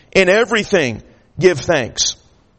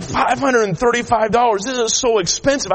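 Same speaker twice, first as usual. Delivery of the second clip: high-pitched incredulous griping; retold fuming; 6 semitones higher than usual. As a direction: balk at the price in mock outrage. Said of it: "I am just fuming".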